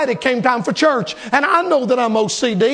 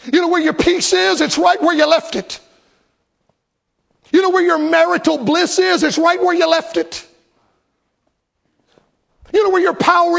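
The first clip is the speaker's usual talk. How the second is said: higher, ringing preacher's refrain; preaching at full force; 4 semitones higher than usual. Acted. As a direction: call it out to the congregation, building.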